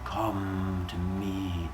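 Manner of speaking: soft, very slow, drawn-out summons; beckoning god. Acted. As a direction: intone it like a mystical god calling someone closer. God beckons with wavy hands.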